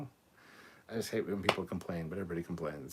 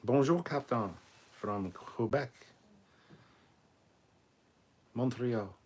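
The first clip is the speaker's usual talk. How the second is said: playful put-on French accent, drawn out; mock French accent; 3 semitones higher, slower than usual. Reading the Quebec fan's greeting in a mock French accent. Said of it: "with my French accent there".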